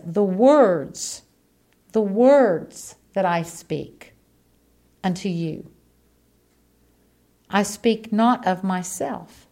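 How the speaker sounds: slow, weighty reading of the verse; solemn scripture reading. Acted. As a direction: lay each word down slowly like holy text. Scripture read slowly.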